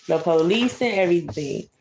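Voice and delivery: ordinary delivery, nothing marked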